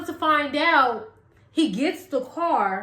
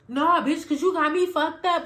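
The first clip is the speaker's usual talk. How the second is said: higher-pitched mock indignant retort; acted sassy comeback; 4 semitones higher than usual. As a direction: imitate her talking back in a quarrel. Acted quarrel, her comeback.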